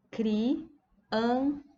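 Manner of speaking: "Criança" drawn out as CRI, AN, ÇA; syllable by syllable. Shown as a demonstration. Teacher showing how often the mouth opens, "Criança" in three parts. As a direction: break "Criança" into three widely spaced syllables. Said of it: "Quantas vezes eu abro a boca".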